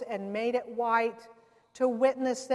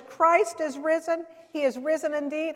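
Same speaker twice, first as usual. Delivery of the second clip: louder, higher, emphatic proclamation; like you mean it; 4 semitones higher, 4 dB louder than usual. Retold or imitated. Friend told to mean her Easter greeting, emphatic. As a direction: proclaim it with full conviction. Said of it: "Say it like you mean it".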